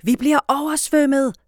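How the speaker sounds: loud, high warning call; alarmed shout. A narrator's reading. Boy playing flood, alarmed shout. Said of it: "råber han".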